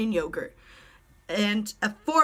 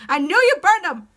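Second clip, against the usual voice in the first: loud, raised-pitch accusation; accusing shout; 9 semitones higher, 10 dB louder than usual. Acted. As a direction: shout it as if catching the culprit out. Accusing rival of burning fingerprints.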